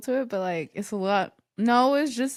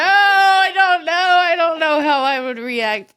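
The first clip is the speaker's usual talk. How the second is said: stunned; 6 semitones higher, 8 dB louder than usual. Real, not acted. Stunned reaction to the story.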